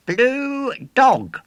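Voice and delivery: high, loud proud announcement; proud showman. A narrator's reading. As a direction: present the exhibit proudly. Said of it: "said the showman ... proudly".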